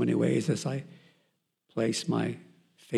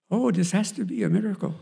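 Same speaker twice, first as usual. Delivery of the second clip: a hint of an Indian doctor, higher and amazed; light Indian accent; 9 semitones higher, 4 dB louder than usual. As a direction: imitate the Indian doctor lightly, stretching still pregnant. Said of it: "he's an Indian guy".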